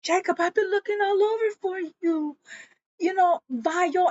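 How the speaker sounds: a hint of a frantic girlfriend; lightly played anxious woman; lightly voiced woman. Woman voiced chasing Jacob.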